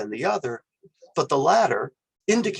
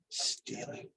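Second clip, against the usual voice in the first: sighed, whispered and softer; disappointed sigh; 14 dB quieter than usual. Shown as a demonstration. Lecturer sighing the word stealing in disapproval. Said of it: "would simply be equivalent to".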